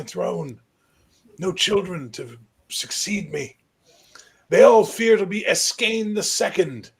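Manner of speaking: put-on high, loud old king's voice. Old king summoning the young high king closer. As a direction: croak it out like an ancient ruler.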